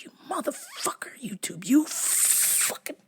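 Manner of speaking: furious whispered cursing; angry whisper at YouTube; whispered curse. Retold or imitated. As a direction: hiss curses under your breath. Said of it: "I was just like".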